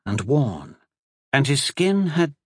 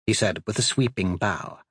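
ordinary delivery, nothing marked

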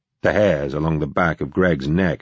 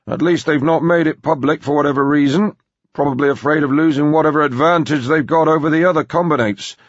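put-on high, loud, emphatic old man; gruff-tempered grandpa; 11 semitones higher, 4 dB louder than usual. Grandpa warning his granddaughter about business rivals, blustering. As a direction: lecture the girl, pitched up and forceful.

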